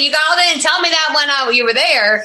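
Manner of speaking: mock indignant. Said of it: "I'm like".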